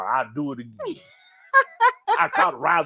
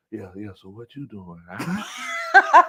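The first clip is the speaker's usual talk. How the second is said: sweet-talker on phone; 4 semitones lower, much slower than usual. Retold or imitated. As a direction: talk low and smooth to a sweetheart. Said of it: "be in that cake and talking to the girl".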